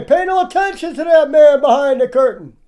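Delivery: high, loud quoted command; mock booming command. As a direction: proclaim it high and loud as the hidden wizard. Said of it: "But he'd say".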